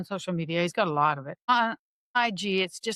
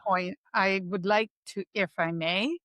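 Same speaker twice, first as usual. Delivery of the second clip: ordinary talk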